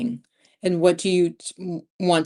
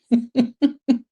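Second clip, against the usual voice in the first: bright laugh; 6 semitones higher, 5 dB louder than usual. Real, not acted. Laughing at own plan.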